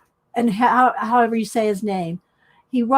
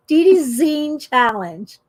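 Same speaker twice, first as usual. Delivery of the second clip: ordinary delivery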